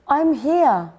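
high, loud squashed little owl; muffled voice. A narrator's reading. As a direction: call out from under the pile. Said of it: "in a muffled voice".

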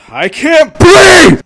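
high, loud, slow wail; panicked shout. Pretend. Streamer mock-panicking that he can't breathe, shouting. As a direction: yell it out drawn long. Sudden yell.

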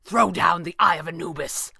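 a firm, mildly forceful order; demanding. A narrator's reading. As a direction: demand it in a character voice. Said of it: "Thatcher demanded".

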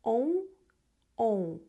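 оу as a nasal ou; nasal ou ending. Shown as a demonstration. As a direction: stretch the ending оу. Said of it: "закінчення".

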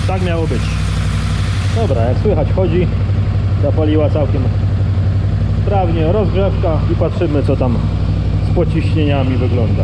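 projecting above the engine's roar. Real, not acted. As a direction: speak up over the running motor. Talks over the running engine.

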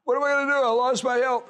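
mock the anxious complainer lightly; worried believer.

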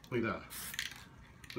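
slow, breathless whisper; whispered in awe at the painting; awed whisper. Real, not acted. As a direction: hush it like a secret marvel. Awed at his own painting.

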